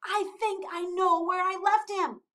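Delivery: high, loud eager realisation; excited cry. A narrator's reading. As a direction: blurt it out eagerly. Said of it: "cried Flip".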